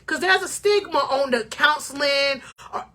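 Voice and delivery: normal talking voice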